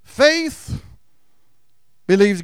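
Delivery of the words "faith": called out. Real, not acted. Preacher calls out key word.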